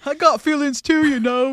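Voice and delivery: a hurt tough guy sulking; Spike voice. Retold or imitated. Spike complaining to Buffy, light mock sulk. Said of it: "That's how Spike talks".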